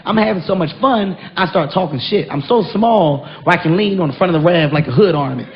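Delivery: ordinary delivery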